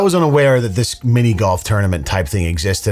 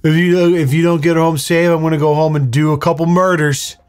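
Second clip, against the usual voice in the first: lightly mocked tough big brother, pitched up; alpha older brother; 6 semitones higher than usual. Mocking a brother who threatens his sister's driver, mock-tough. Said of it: "alpha older brother Facebook memes".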